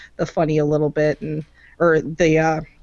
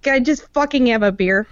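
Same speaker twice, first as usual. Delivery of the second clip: Tallahassee; 5 semitones higher than usual. Retold or imitated. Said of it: "but you'd be like".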